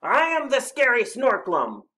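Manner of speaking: high, loud monster snarl; snarled boast. A narrator's reading. Snorklum threatening Rabbit, loud snarl. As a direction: declare it fiercely to frighten. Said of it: "he snarled".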